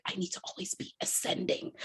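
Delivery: hushed self-talk. Retold or imitated. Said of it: "I was like".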